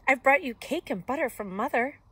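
put-on lilting girlish announcement, without squeaking; lightly voiced Little Red. A narrator's reading. Little Red bringing cake to Granny, lifted girlish voice.

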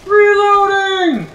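very high, drawn-out yell; shouted callout. Real, not acted. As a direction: holler it long and loud to the team. Calling out reload to teammates.

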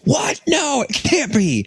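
squeaky high disbelieving outcry; shocked disbelief. Retold or imitated. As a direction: gasp it out, shocked and denying. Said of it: "And Steve Dallas is like".

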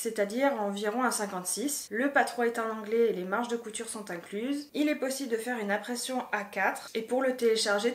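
ordinary talk, nothing marked

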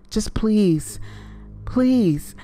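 desperate, mounting plea. A narrator's reading. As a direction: plead for your life, voice rising. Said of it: "I offered desperately".

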